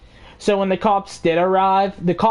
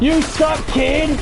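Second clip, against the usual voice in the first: taunting; 8 semitones higher, slower than usual. Retold or imitated. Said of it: "he's like".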